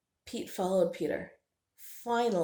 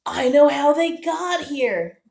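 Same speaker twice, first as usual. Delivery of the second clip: giggling; 8 semitones higher, 12 dB louder, slower than usual. Acted. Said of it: "Pete giggled".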